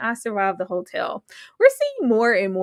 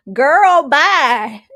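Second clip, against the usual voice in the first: loud, high, drawn-out brush-off; big dismissive kiss-off; sassy dismissal; 4 semitones higher, 8 dB louder than usual. Acted. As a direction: wave them off at full volume. Mock dismissal of Lamont's move.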